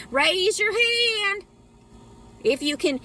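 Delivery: high, drawn-out revival call; mock preacher's call. Calling on the crowd to agree, high mock preacher's cry. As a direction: call it out slowly, high over the congregation.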